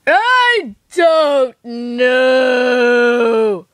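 long, loud, stretched shout; drawn-out shout. Acted. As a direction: let the words drag out into a shout.

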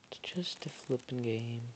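whispered under the breath; whispering. Real, not acted. Muttering to self after bad luck.

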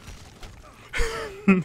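high-pitched falling laugh. Real, not acted. Laughs after freezing.

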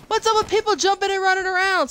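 high, fast complaint; ranting at other players; exasperated rant. Real, not acted.

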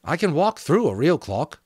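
lightly acted comfort; comforting character voice. A narrator's reading. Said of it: "She spoke partly to comfort him".

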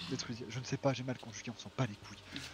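quiet, low, dismissive mumble; muttered aside. Real, not acted. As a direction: drop your voice and shrug it off. Muttering self-correction.

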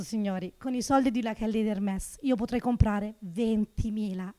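usual speaking voice

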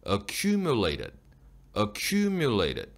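"Accumulated" stressed on "cu", "mulated" falling away; slow "Accumulated", no force after "cu"; unstressed falling ending. Shown as a demonstration. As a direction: let "mu" in "Accumulated" drop off unstressed. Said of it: "힘을 안 줘도 됩니다".

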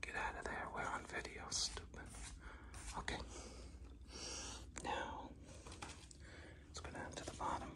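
very soft, hushed whisper; barely audible aside. Real, not acted. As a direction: whisper so the camera barely catches it.

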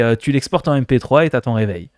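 usual speaking voice

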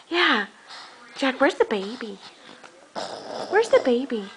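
normal talking voice

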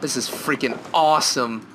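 ordinary talk, nothing marked

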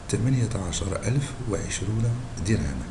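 normal talking voice

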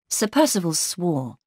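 plain reading voice

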